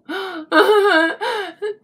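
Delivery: sharp, high mock gasp of disbelief; dramatic gasp. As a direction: gasp in exaggerated shock. Mock outrage at crying patients.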